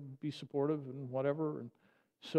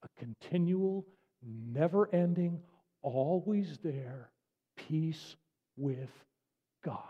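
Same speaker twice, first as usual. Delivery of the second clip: lingering, drawn-out emphasis; slow, reverent preacher's emphasis; slow dwelling; slower than usual. Acted. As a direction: stretch each word like a promise. Slow savoring sermon line.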